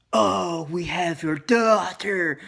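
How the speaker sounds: a fake-scary phone threat, drawn out; mock scary voice. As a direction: ham up a spooky ransom threat. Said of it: "trying to sound all scary".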